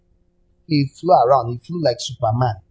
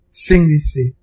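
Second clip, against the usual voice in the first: normal talking voice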